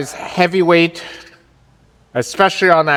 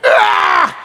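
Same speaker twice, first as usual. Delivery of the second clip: straining shout. Real, not acted. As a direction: push out a big effort scream. Grinding out the last rep.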